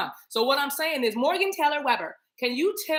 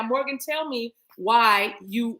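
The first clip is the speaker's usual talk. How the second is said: ordinary talk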